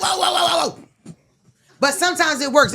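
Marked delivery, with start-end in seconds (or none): 0.0-1.1 high mock alarm. Reacting to insult.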